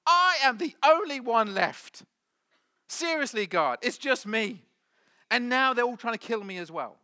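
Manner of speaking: loud, high mock self-pity; whining. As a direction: wail the complaint at God, hammed up. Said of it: "and he just whines".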